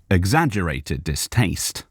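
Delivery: ordinary delivery, nothing marked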